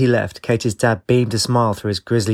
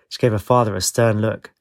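ordinary delivery, nothing marked

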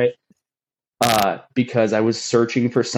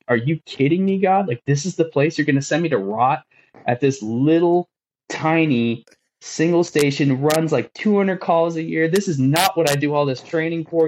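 higher, exasperated disbelief; frustrated rant at God; 7 semitones higher than usual. Retold or imitated. Complaining to God about the tiny station, higher exasperated rant. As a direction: vent it to God, higher and incredulous. I'm just, like, sitting there so frustrated.

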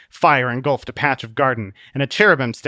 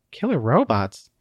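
usual speaking voice

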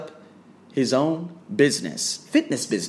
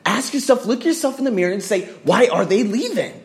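high, raised-voice challenge building to a shout; exasperated demand; 9 semitones higher, 4 dB louder than usual. Real, not acted. Trainer telling gym owners to face themselves, exasperated. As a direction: drive the question home, louder at the end. Heated rant demanding self-questioning.

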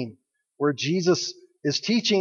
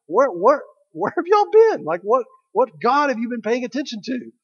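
incredulous Jesus; 6 semitones higher than usual. Retold or imitated. Pastor voicing Jesus scolding the Pharisees, mock amazed. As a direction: pitch shoots up on y'all as if amazed.

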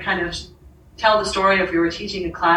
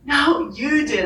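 tearful insistence; 5 semitones higher, slower than usual. Retold or imitated. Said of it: "now I'm really crying again".

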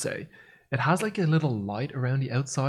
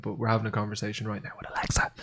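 soft, low, fast murmur; hushed aside; 4 semitones lower, 7 dB quieter, faster than usual. Real, not acted. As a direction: slip it in quietly and quickly. Aside about the device listening.

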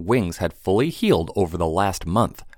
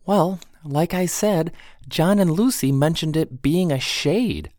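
put-on young woman voice; 9 semitones higher than usual.

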